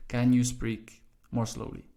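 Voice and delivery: ordinary talk, nothing marked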